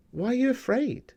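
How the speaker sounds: high gentle rebuke, lightly voiced; Jesus rebuking. Jesus gently questioning his frightened disciples. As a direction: ask it like a patient teacher. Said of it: "Jesus says".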